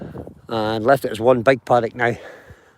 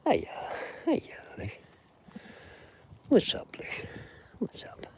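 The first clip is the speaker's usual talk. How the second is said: whispering; 12 semitones higher, 14 dB quieter than usual. Real, not acted. Whispering to the horse.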